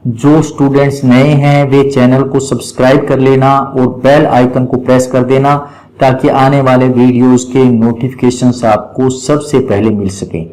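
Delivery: ordinary talk, nothing marked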